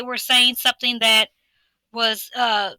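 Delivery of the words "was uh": ordinary talk, nothing marked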